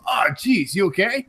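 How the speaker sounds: lightly voiced cartoon oaf checking in; Peter. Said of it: "Peter goes up and goes".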